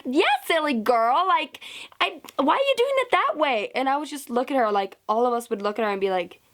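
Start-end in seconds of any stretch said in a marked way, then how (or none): 0.0-3.7 high-pitched condescending imitation; high mock-sweet telling-off; mocking coach. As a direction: mimic a patronizing coach in a high voice. Coach mocked in retelling.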